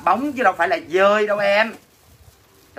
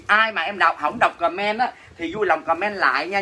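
ordinary talk

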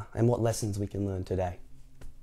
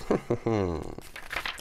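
drawn-out giggle trailing into a hum; scheming chuckle; 4 dB quieter than usual. Acted. Reader mimics delighted Mister Tickle, chuckling softly. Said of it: "It's like".

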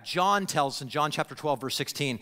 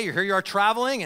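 usual speaking voice

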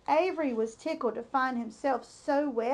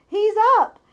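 loud, higher cheering shouts; crowd cheering; 4 semitones higher, 9 dB louder, slower than usual. A narrator's reading. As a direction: cheer the pig back on his feet. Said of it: "yelled the crowd".

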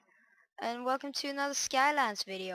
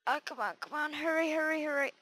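hurrying someone; 5 semitones higher than usual. Real, not acted. Rushing to get up before night.

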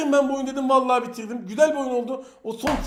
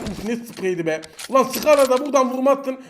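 normal talking voice

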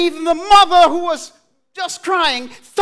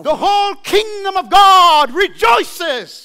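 preaching shout; 6 semitones higher, 4 dB louder than usual. Real, not acted. Preacher's climax on rejoicing.

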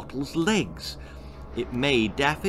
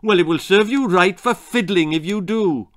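unsympathetic; 5 semitones higher, 6 dB louder than usual. Acted. Mother retorts.

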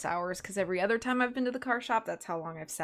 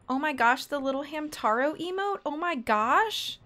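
excited gushing; 9 semitones higher, 5 dB louder than usual. Real, not acted. Streamer delighted by a viewer's little Hamtaro emote, excited gushing. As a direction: gush louder and higher as it goes.

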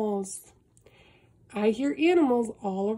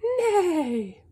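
higher, louder neigh; horse neigh; 6 semitones higher, 4 dB louder than usual. Acted. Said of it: "Horses say".

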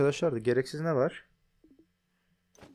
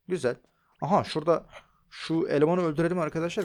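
normal talking voice

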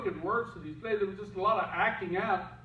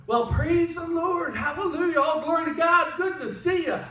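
fake cheerful churchgoer; 10 semitones higher, 4 dB louder than usual. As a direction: gush it like a fake saint. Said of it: "They're the ones that come".

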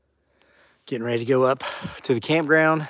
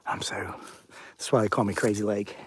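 ordinary talk, nothing marked

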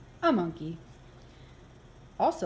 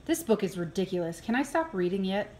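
plain reading voice

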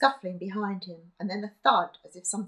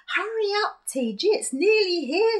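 high, loud urgent hurry; 11 semitones higher, 6 dB louder than usual. Acted. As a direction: hurry him up, it's nearly here. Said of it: "she said urgently".

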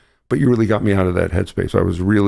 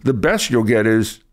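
usual speaking voice

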